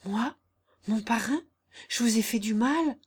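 hesitant, disbelieving questions with rising ends; a touch of dismay, broken by pauses; appalled. A narrator's reading. Jeanne accused of hurting her godfather, appalled. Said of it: "dit Jeanne tout épouvantée".

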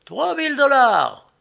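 very high, loud head-turning cry; shouted bid. A narrator's reading. Newcomer outbids the hatter from the crowd, high shout. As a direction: burst in over everyone with the bid. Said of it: "cria une voix qui me fit tourner la tête".

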